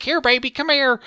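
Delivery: very high, loud cooing at the dog; mock baby talk to dog. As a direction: coo way up high like to a pet. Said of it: "She's like".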